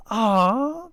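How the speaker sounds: high, cooing aw; sweet, rising coo; child's fond aw. A narrator's reading. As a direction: coo it as if touched. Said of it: "the lead child proclaimed".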